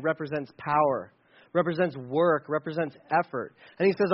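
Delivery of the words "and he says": usual speaking voice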